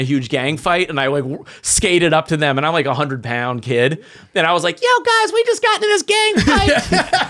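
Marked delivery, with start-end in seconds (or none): 4.8-7.3 put-on very high, excited kid bragging; squeaky-high mock bragging; showing off. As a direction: imitate a small kid boasting, pitch way up. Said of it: "I was trying to show off".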